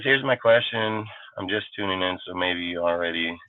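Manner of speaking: put-on fan voice. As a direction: read the fan's question in a deep dopey voice. Reads a fan's chat question.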